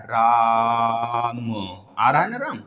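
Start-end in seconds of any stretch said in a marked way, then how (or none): 0.0-1.5 राम with the आ stretched very long; drawn-out raam. Shown as a demonstration. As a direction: hold the vowel of राम far past normal. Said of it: "तो राम में क्या आ रहा है".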